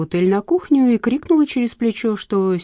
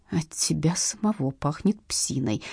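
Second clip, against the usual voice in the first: low, slow, soft resentment; soft, slow aside to herself; hateful inner thought; 5 semitones lower, 7 dB quieter, slower than usual. A narrator's reading. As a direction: think it quietly, with contained hatred. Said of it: "глядя на него чуть ли не с ненавистью".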